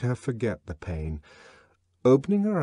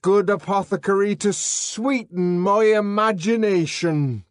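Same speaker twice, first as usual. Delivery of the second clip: higher, louder, slow declamation; raving savage reciting; 10 semitones higher, 7 dB louder than usual. Acted. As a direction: chant the verses like a madman. John ranting Shakespeare.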